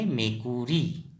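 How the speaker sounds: मेकुरी said slowly, syllable by syllable; slow clear Assamese word. Shown as a demonstration. Teacher drills pupils on मेकुरी, slow and clear. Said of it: "क्या कहेंगे?".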